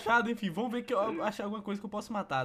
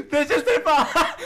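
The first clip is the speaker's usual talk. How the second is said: laughing yell; 5 semitones higher, 12 dB louder than usual. Real, not acted.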